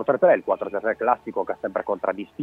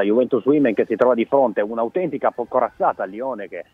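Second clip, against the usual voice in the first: ordinary delivery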